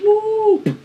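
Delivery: high cheering woo; excited whoop. Real, not acted. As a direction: cheer the reveal. Product reveal.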